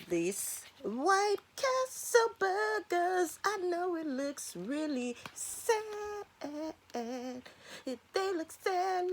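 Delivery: high whiny voice. Acted. Pitying the sad-looking burgers.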